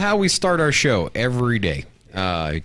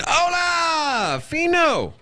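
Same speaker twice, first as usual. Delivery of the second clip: high, stretched "Hola, Fino"; hype greeting; 11 semitones higher, much slower than usual. Real, not acted. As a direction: call out to the new guy with energy. Greeting new chat viewer loudly.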